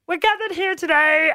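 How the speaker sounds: high, pinched frog-puppet voice; Kermit the Frog. Retold or imitated. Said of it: "like Kermit the Frog was the celebrant".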